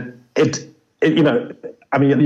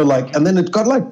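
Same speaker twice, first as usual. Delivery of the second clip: normal talking voice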